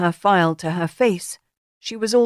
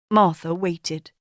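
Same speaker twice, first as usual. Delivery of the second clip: ordinary delivery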